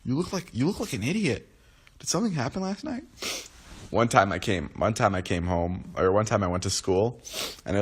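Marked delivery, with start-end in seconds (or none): 0.0-3.0 higher mocking voice, soft; mocking classmate. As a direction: tease like a nosy classmate. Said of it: "everyone's like".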